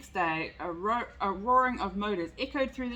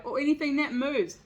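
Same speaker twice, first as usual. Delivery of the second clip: louder, a quail's wailing cry; 5 semitones higher, 5 dB louder than usual. A narrator's reading. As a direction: wail it in mild alarm. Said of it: "wailed a quail".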